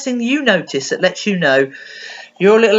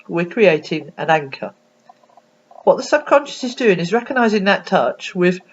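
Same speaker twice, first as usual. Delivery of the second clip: ordinary talk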